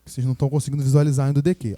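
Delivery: usual speaking voice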